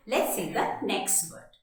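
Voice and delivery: ordinary delivery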